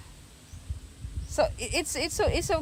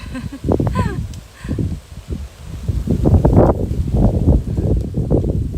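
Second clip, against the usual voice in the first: loud, high, breathless scared laughter; shriek-laugh as the stove hisses at you; nervous shrieking laugh; 10 semitones higher, 12 dB louder than usual. Real, not acted. Scared of the hissing stove, bursting into a loud high shriek of laughter.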